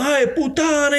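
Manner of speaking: high mock Italian dad cursing; hammed-up Italian accent, scolding. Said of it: "pure Italian".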